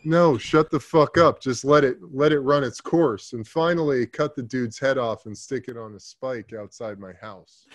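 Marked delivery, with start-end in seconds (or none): 0.0-3.3 louder, half-laughing retold snap; a loud, mock-irritated outburst at himself; mock angry shout. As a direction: yell it back at the inner voice, partly amused. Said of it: "And I'm going".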